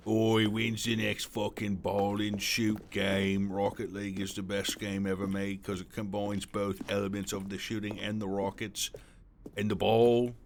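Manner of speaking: mock British lad accent, slow at first. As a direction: lay on a cheeky British accent. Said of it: "I can't do my British accent".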